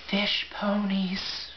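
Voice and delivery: hushed, slow, awed whisper; breathless whisper. Acted. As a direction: breathe it out in wonder. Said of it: "Tyson caught his breath".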